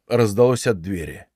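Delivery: usual speaking voice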